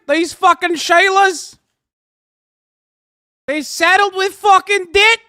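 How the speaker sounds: loud, high mock Aussie rant; hammed-up Aussie accent, loud and high. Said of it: "the sneaky Aussie accent".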